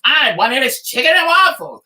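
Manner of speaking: wife mocking husband's stubborn order, whiny mock voice; mimicking husband. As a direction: imitate him as a stubborn child. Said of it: "I said".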